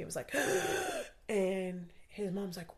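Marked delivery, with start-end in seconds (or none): 0.4-1.2 gasp. Retold or imitated. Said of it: "knocked the air into me".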